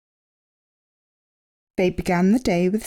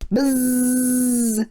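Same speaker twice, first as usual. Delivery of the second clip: buzz sound; 5 semitones higher than usual. A narrator's reading. Giant bee bursts in.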